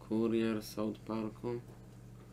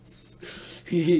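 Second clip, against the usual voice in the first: loud laugh; 7 semitones higher, 10 dB louder than usual. Real, not acted. Laughs at replayed clip.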